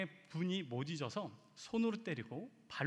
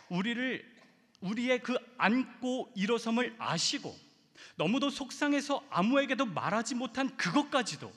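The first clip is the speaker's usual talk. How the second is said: higher, louder full-force sermon cry; fervent preaching; 7 semitones higher, 8 dB louder than usual. Real, not acted. 목사가 회중에게 하나님을 외치며 뜨겁게 설교. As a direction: lift the congregation with fervour. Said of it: "preaching at full force".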